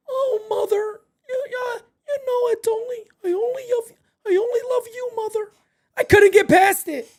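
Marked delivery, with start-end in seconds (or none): 0.1-5.5 high, teary mock sobbing plea; mock crying voice. Streamer parodying the show's killer, high and teary. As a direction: play it as a whiny, sobbing mama's boy. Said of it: "I couldn't get past The Voice".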